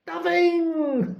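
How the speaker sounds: high, cackling triumphant ghost; high mocking shriek then a gleeful laugh; gloating poltergeist. A narrator's reading. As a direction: crow over Filch with a high cackle. Peeves taunting Filch.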